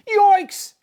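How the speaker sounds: high, loud startled yelp; startled cry. A narrator's reading. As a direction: yelp in shock. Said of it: "cried Joey Mackerel".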